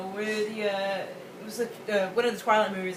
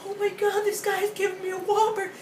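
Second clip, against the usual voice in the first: high gushing fan voice; starstruck fan; 11 semitones higher, 3 dB louder than usual. Retold or imitated. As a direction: gush over the Whopper. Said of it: "she was like".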